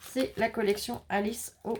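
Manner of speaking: ordinary talk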